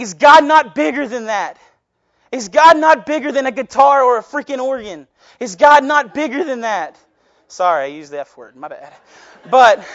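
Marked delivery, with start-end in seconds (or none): 0.0-7.0 loud, high, exasperated challenge; impassioned near-scream. Real, not acted. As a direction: cry it out, barely holding back a scream. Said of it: "I have to hold it back from screaming".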